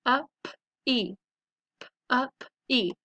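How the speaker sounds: "puppy" in pieces, p, up, ee; segmented phonics "puppy"; sounded out. Shown as a demonstration. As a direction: sound out "puppy" twice with gaps. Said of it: "So let's practice them".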